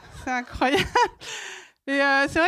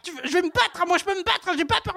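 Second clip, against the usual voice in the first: put-on belligerent drunk; 3 semitones higher than usual. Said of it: "j'ai fait parler mes ovaires".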